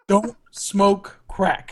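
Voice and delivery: very slow, drawn-out warning; slow PSA line. Pretend. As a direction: spell it out slowly like a PSA. Continues mock PSA.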